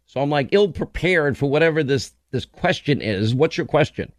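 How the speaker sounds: usual speaking voice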